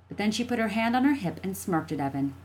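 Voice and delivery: ordinary delivery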